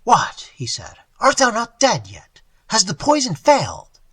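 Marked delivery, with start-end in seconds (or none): 0.0-0.5 startled. Acted. Robber startled by the old man. 1.2-4.0 loud and higher, cold and harsh; harsh demand. Acted. Robber confronts poisoned guardian.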